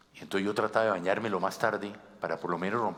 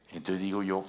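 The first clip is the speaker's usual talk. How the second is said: ordinary delivery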